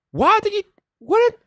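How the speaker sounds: sudden very high surprised yelp; startled squeak. Real, not acted. Streamer reacting to a broken comment, high startled yelp. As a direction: jump up high in disbelief.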